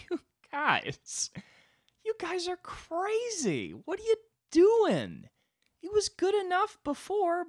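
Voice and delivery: very high, drawn-out mock disbelief; astonished God. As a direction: squeal it like an astounded, scandalized God. God reacting to crack, amazed.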